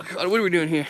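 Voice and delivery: normal talking voice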